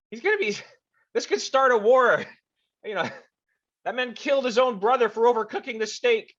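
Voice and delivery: high, loud angry tirade; furious yelling. Acted. Official scolding the crew in a loud, high fury. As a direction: rage at the prisoners for the kidnapping. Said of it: "He just starts yelling".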